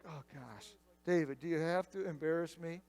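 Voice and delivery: low, hushed mock exasperation; softly exasperated imitation; embarrassed brother. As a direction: groan it under your breath at a little brother. Acts out embarrassed older brother.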